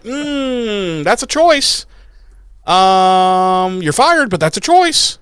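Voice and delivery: very high, drawn-out sceptical hum and verdict; mock-polite disapproval. Imagined boss reacting to an actor's audition, high-pitched mock-polite disapproval. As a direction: pitch it way up, feigning tact.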